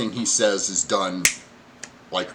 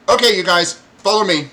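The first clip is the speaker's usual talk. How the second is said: high, loud goblin; 10 semitones higher, 10 dB louder than usual. Acted. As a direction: herd the group along as a goblin. Goblin leads them off.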